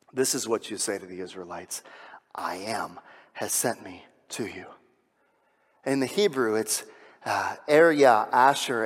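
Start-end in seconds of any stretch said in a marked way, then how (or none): 0.1-4.6 hushed, unvoiced whisper of God's words; reverent whisper. Preacher whispering God's words to Moses in awe. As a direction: breathe it like a holy secret. God's holy name revealed.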